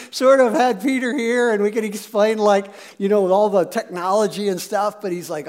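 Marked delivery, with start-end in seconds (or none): none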